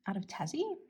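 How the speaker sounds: "Tassie" rises at the end, question-like; upward inflection. Real, not acted. Naming the brand's home for viewers, rising on "Tassie". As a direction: let "Tassie" lift like you're asking. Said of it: "See, I went up there".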